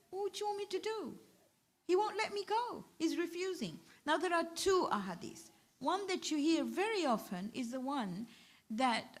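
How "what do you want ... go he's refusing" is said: put-on high, tearful child's plea; a boy begging his mother, a touch of tears; pleading child. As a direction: beg like a boy near tears, pitched up. Child pleads after starting to cry.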